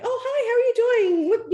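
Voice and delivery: high, loud chirpy shop greeting; sing-song bubbly hello; overly cheery greeting. Retold or imitated. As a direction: greet strangers with too much cheer. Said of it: "be, like, so cheery here".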